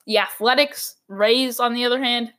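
ordinary talk

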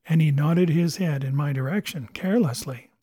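plain reading voice

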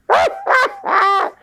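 shrieking high laugh. Real, not acted. Man laughing at his two kings joke, squealing high.

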